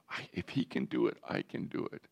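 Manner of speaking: put-on whispered, as a kid to himself; hushed self-talk. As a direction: imitate a child quietly realising it. Kids' thought as they walk away.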